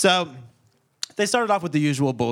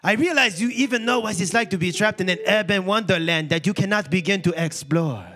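hammed-up, higher-pitched French accent; 7 semitones higher, 4 dB louder than usual. Pierre the French cat confessing feelings to Einstein, theatrical French accent. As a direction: declaim like a passionate French suitor. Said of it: "I'm gonna do a French accent".

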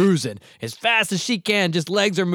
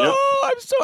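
put-on whiny kid voice; 4 dB louder than usual. Daughter whining, voiced in story.

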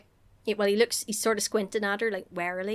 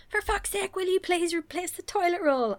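high, strained pleading gripe; mock exasperation; 9 semitones higher than usual. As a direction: nag in a high put-on voice. Acts Steve's exasperated demand.

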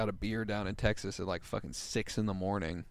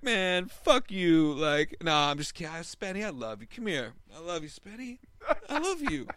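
put-on high, sloppy drunk swing from insult to love; drunk Seth; 7 semitones higher, 3 dB louder than usual. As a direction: drunk buddy cursing then hugging his friend. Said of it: "he was like".

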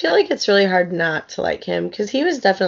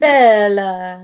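"Bella" in a hammed-up Italian accent, louder; mock Italian accent; 7 dB louder than usual. Shown as a demonstration. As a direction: belt out "Bella" like a bad Italian. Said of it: "say it with an Italian accent".

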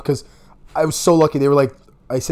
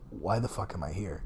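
low, much softer, half-awake; groggy confused mutter; 7 semitones lower, 15 dB quieter than usual. Retold or imitated. Waking up after a blackout, a soft groggy mutter. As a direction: mumble it like you just came to.

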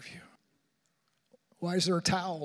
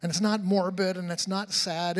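ordinary talk

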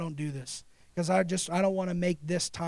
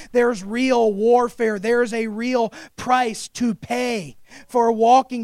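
slow, louder, weighty sermon lines; deliberate pulpit emphasis; slow emphatic preaching; 2 semitones higher, 6 dB louder, slower than usual. Acted. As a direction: lean on every word, slowly.

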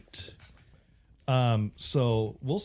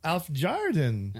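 "Af Jardin" very slow and pitched up in a fake foreign accent; bad Swedish accent; 6 semitones higher, much slower than usual. Shown as a demonstration. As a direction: stretch out a clumsy mock Swedish accent. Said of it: "isn't that weird?".

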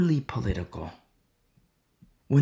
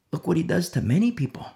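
ordinary delivery

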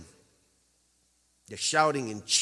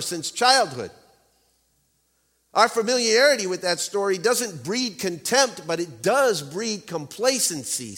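preaching; 5 semitones higher, 5 dB louder than usual. Real, not acted. Preaching with full force.

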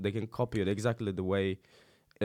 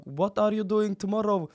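put-on higher, punchy American street accent; 8 semitones higher, 4 dB louder than usual. Speaking English abroad, mock American accent.

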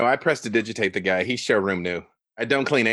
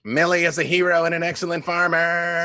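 booming, raised proclamation; magically amplified voice; 7 semitones higher than usual. Acted. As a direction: boom it out by magic. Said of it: "I cast Thaumaturgy so that my voice booms".

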